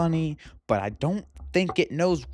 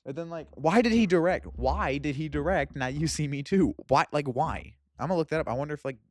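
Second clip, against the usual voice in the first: usual speaking voice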